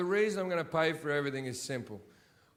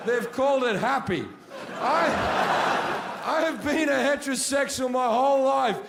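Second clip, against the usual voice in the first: ranting shout; 6 semitones higher, 5 dB louder than usual. Real, not acted. Comic rant builds to shouting.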